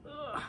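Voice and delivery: sharp high ugh of frustration; frustrated grunt. Shown as a demonstration. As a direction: grunt at the stuck jar. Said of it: "Step one, you notice that you're having a feeling".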